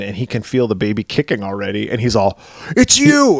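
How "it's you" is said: shocked realization. Retold or imitated.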